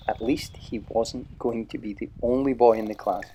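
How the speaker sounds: usual speaking voice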